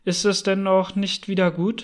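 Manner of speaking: usual speaking voice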